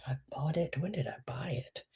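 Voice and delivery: soft whisper, trying to remember; hushed self-questioning; whispered musing. Real, not acted. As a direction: puzzle it out under your breath. Whispering while recalling.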